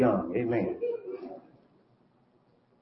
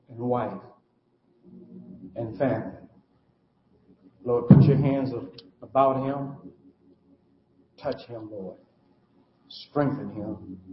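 closing prayer; 5 semitones lower than usual. Acted.

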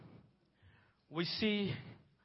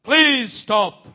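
raised, urgent plea to halt; urgent command; 3 semitones higher, 5 dB louder than usual. Acted. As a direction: call out loudly to halt someone. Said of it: "the voice of the Lord came there".

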